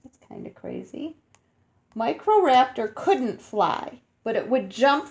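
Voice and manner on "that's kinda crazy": whisper. Real, not acted. Amazed aside whispered.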